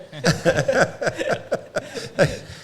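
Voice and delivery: loud laugh. Real, not acted. Laughing at the Texas-size hat.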